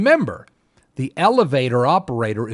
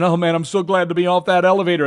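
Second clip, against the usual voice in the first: relieved little guy; 5 semitones higher, 5 dB louder, faster than usual. Retold or imitated. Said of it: "the little guys are all saying".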